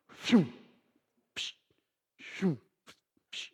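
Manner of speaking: whooshing digger plunges, each with a 'psh'; spaced-out mouth-made whoosh and thud; digger sound effects. Retold or imitated. Mimics posthole digger going in.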